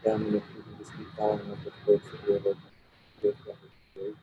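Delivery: sounding like a wind tunnel; muffled, windy call audio; echoing tunnel. Real, not acted. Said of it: "It sounds like you're in a wind tunnel".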